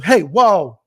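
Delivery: loud, quick warning call; sharp warning call. Retold or imitated. A stranger at a hospital objecting, loudly. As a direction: call out to stop someone. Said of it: "someone says".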